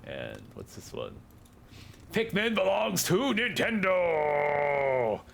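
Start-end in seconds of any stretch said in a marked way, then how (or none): 2.1-5.2 slow, high, booming declaration; grand announcer. Acted. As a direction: proclaim it like a movie trailer. Reading disclaimer in grand voice.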